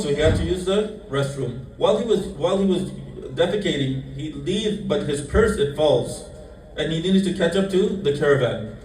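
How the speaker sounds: ordinary talk, nothing marked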